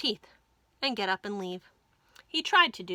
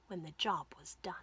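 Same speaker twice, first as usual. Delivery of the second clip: whisper; 4 semitones lower, 8 dB quieter, faster than usual. A narrator's reading. Fox's secret thought.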